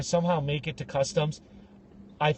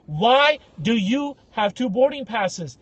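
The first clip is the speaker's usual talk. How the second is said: drawn-out slow interrogation; a customs officer slowly repeating his question; slow suspicious question; 3 semitones higher, 4 dB louder, slower than usual. Retold or imitated. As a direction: stretch it out like a stern officer.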